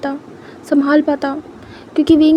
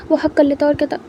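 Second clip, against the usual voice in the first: ordinary delivery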